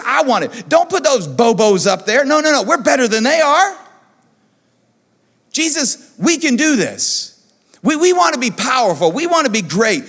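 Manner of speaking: put-on jealous grown men pleading like kids; a mock jealous outburst; indignant disciples. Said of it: "they were indignant".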